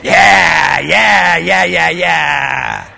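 put-on bored kid shout. Kid blowing off the visiting doctor with a loud yeah. Kid's careless brush-off of guest.

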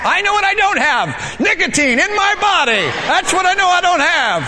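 high, sharp comeback; defiant retort. Retold or imitated. Smoker talks back to God, high defiant retort. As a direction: throw it back up high and cutting.

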